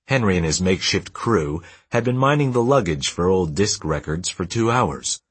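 ordinary delivery

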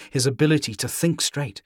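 usual speaking voice

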